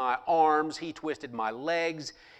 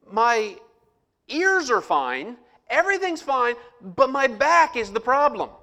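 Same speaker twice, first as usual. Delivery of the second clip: exasperated protest; 9 semitones higher, 3 dB louder than usual. Retold or imitated. Exasperated patient confronting the doctor.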